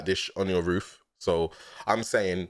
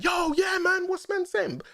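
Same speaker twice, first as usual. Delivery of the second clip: much higher, shouted ad-lib energy; hype man shout. Retold or imitated. Hype man backing up the artist, shouting high. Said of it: "Someone hype".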